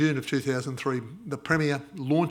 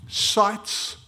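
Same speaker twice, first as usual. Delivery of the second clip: ordinary talk, nothing marked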